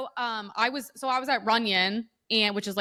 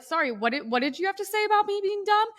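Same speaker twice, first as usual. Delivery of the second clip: confronting challenge; 10 semitones higher than usual. Retold or imitated. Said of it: "And I was like, I was like".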